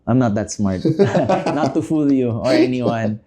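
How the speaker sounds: normal talking voice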